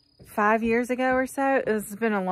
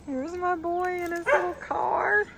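doting mother; 9 semitones higher, slower than usual. Real, not acted. Mother sees son at school.

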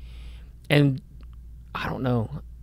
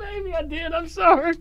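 laughing. Real, not acted. Caught out, laughing apology.

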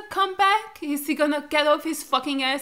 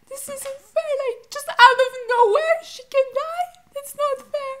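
higher, wailing through tears; sobbed protest, much higher in pitch; crying; 10 semitones higher, 4 dB louder than usual. Real, not acted. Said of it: "That's why I'm crying".

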